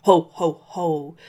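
hearty spoken ho-ho-ho; Santa's measured belly laugh, falling in pitch. A narrator's reading. Santa answers the girl who asks if they met, jolly ho-ho laugh. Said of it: "Santa laughed".

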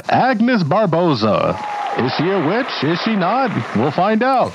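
high, slow teasing announcer; old Hollywood announcer. Acted. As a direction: tease the mystery like a vintage host.

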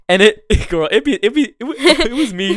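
high laughing admission; laughing confession. Real, not acted. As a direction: giggle through it, caught out.

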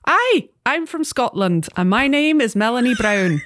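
hammed-up Scottish accent, drawn out; slow, high Scottish greeting. Book club guest introducing herself, in a bad Scottish accent. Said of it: "I'm gonna do terrible accents".

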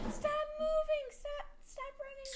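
high shouted command; cop yelling. Retold or imitated. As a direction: yell it like a chasing cop. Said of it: "The cop".

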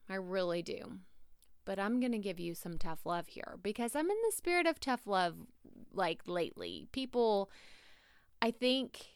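normal talking voice

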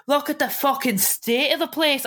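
mock-angry, high-pitched Scottish attempt; attempted Scottish accent. Co-host retelling housemate swaggering in, attempted Scottish accent. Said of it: "try and do a Scottish accent".

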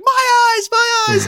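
high-pitched, loud horrified shriek; screaming. Retold or imitated. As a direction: shriek it like a scandalized sitcom character. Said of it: "She starts screaming".

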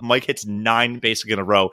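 normal talking voice

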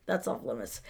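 low, soft quoted ruling; muttered house rule; hushed aside. Retold or imitated. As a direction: drop it low and quiet. Quoting Monk's house rules.

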